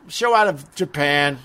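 normal talking voice

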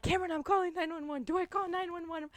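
high, fast panicked voice. Retold or imitated. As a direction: rush it out in panic. Panicked call while being chased.

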